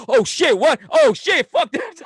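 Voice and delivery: high, loud startled yelps; hammed-up alarm on the street; mock panic. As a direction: shout it like a spooked newcomer. Acts out boy panicking in city.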